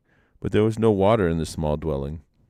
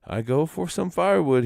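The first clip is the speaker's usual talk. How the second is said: rabbit voice; 9 semitones higher, 3 dB louder than usual. Acted. Manston announces his errand.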